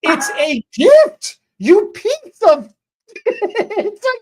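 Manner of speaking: high, loud, slow furious yell; exasperated snap. Retold or imitated. Stylist yelling at ungrateful client, high and furious. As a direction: spell it out in outrage.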